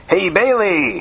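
high-pitched, delighted greeting; happy cry. A narrator's reading. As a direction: call out joyfully to a friend. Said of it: "he cried with happiness".

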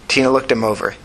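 ordinary delivery, nothing marked

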